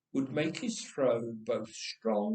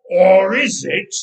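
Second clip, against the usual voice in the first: menacing giant; 4 semitones higher, 15 dB louder than usual. Acted.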